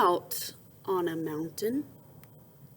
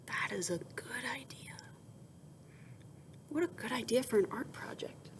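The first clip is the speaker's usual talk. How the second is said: hushed whisper, quicker at the end; whispered praise; 2 semitones higher, 10 dB quieter, faster than usual. Acted. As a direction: whisper your delight.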